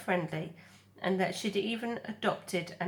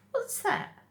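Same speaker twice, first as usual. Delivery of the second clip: high gosling voice. Acted. Gosling voice in read story.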